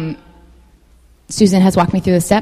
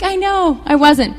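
defensive; 10 semitones higher, 4 dB louder than usual. Retold or imitated. Defensive reply to her friend.

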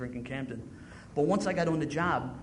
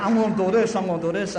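boastful brag; 4 semitones higher, 9 dB louder than usual. Retold or imitated. Acting his own boasting self.

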